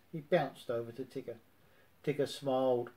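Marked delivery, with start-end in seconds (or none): none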